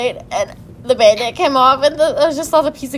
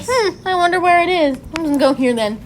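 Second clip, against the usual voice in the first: mock clueless doctor; 8 semitones higher than usual. Imitating the clueless new doctor.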